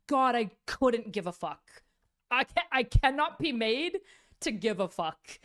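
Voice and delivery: loud, slow, dismissive drawl. Retold or imitated.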